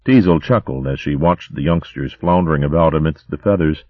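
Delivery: ordinary delivery, nothing marked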